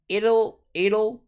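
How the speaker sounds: "It'll" said as "iRl", T as a tapped R; flapped t. Shown as a demonstration. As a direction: repeat "It'll" with a light tapped R. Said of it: "La R es como una R más bien".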